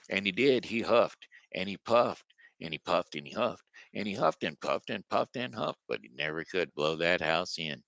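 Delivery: usual speaking voice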